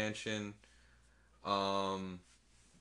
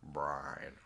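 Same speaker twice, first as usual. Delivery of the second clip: low mumbled voice; 8 semitones lower, 8 dB quieter than usual. Retold or imitated. Imitating Toretto saying Brian.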